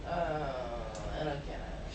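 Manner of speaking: low, quiet puzzled mumble; Axel, clueless. Acted. Player voicing a clueless Axel, low and quiet. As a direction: say it small, low and lost. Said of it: "Axel's just sort of like".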